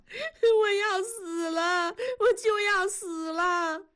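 frightened daughter crying that she is dying. Acted. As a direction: weep it out, terrified. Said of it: "燕妮哭着说".

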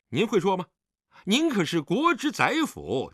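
high-pitched, insolent retorts; mocking, provocative indignation; defiant sarcasm. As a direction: throw the blame back at your father. Son sarcastically argues back.

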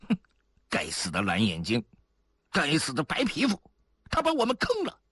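cursing that climbs to a high pitch; angry cursing. A narrator's reading. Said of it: "这时候有人骂道". (咒骂，越骂越高)

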